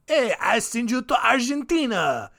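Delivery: high-pitched, badly faked French serial killer accent; dubious French accent. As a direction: do a bad, unsure French accent. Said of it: "I don't know what accent that is. French".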